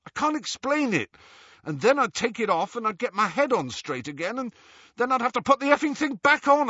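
high-pitched, agitated confession; distressed outburst. A narrator's reading. Ron backing away from the locket, in distress. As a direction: plead helplessly, pitch climbing.

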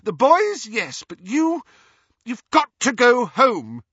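high, loud, outraged forbidding; high-pitched scolding yell; mother's shout. A narrator's reading. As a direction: lay down the law at the top of your voice. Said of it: "Missus Weasley shouted at her daughter".

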